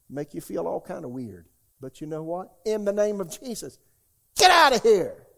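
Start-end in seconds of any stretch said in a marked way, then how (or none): 2.7-5.3 loud, much higher command to leave; shouted rebuke of devils in the room; rebuking shout. Retold or imitated. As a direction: cast them out forcefully. Casting devils from his room.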